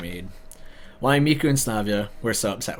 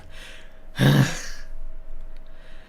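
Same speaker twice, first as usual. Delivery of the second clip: 1 long sigh; 2 semitones higher than usual. Real, not acted.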